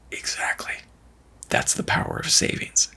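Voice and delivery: whispered. Retold or imitated. Dad answers daughter, whispered.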